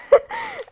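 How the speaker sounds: high burst of laughter; sudden high laugh. Real, not acted. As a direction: break into a giggle. Laughing at own memory.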